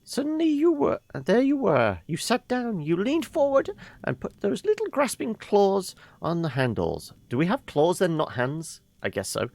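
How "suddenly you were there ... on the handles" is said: put-on high, airy old woman voice; a fond grandmother reminiscing; old lady voice. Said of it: "So we should do the old lady voice".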